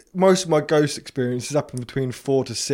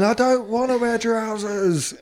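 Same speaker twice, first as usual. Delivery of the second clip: put-on higher, slow sulky boy; a sulky ghost boy whining at his dad; whiny ghost boy; 7 semitones higher, slower than usual.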